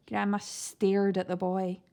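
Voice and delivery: plain reading voice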